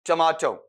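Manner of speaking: "टमाटो" as tuh-MAA-tau; corrected tomato. Shown as a demonstration. Teacher after checking syllables with students, corrected "tuh-maa-tau". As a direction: say "टमाटो" with long aa and a final au glide. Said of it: "इस तरीके से।".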